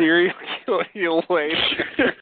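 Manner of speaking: laughing through the sign-off; giggling while saying it. Pretend. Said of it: "repeats catchphrase laughing".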